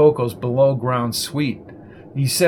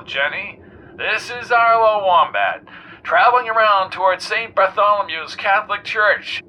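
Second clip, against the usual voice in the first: jaunty talk-radio host; 6 semitones higher than usual. A narrator's reading. Arlo Wombat quizzing the weatherman on air, breezy host.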